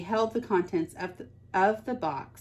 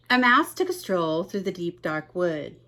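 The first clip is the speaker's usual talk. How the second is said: usual speaking voice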